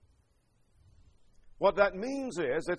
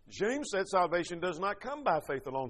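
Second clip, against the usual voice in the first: ordinary talk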